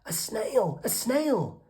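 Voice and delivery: high, loud startled shriek; shocked shriek. A narrator's reading. Teacher spotting a snail in class, high shocked shriek. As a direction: scream as if she's seen a mouse.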